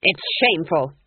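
loud, sharp disapproval; disapproving snap. A narrator's reading. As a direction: snap it out in indignation. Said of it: "snapped Granny".

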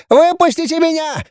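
very high piercing squeal; piercing shriek. A narrator's reading. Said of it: "завопила пронзительным голоском".

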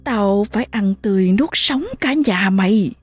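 high, eerie demon voice mocking the mother; the possessing spirit sneers through the boy; possessed child. Spirit taunting the terrified mother, eerie high voice.

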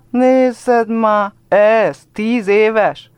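put-on high, loud girlish taunt, very slow at the end; little girls taunting. A narrator's reading. Little girls shove the boy and taunt him, high and loud. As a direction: squeal it like a mocking little girl. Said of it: "lögdösik a kisfiút a kislányok".

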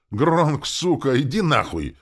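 loud, high furious outburst; angry cursing. A narrator's reading. Injured man curses the orc dragging him, angrily. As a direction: swear at him to back off.

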